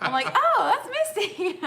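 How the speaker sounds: high, loud, laughing realization. Real, not acted. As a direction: laugh your way through the words. Laughs telling her mistaken-voice story.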